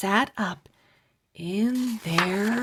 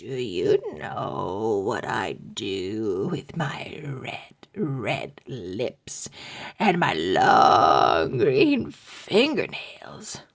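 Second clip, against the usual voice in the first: low whispered zombie rasp, much lower; like dead bones rattling; 9 semitones lower than usual. Acted. Zombie menacing the sleeping boys, low rattling whisper. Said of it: "Her voice was like dead bones rattling".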